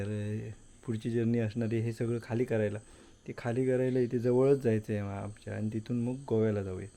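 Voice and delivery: hushed, low voice so nobody wakes; quiet at night. Real, not acted. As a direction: keep it soft, family's asleep. Home late at night, everyone asleep.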